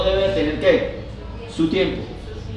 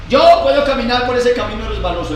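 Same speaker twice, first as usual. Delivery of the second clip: cocky boast, a bit louder; boastful person; 5 semitones higher, 5 dB louder, faster than usual. Retold or imitated. Preacher voicing a boastful youth, cocky. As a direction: brag you can walk the slippery road. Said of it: "que dicen ahí así".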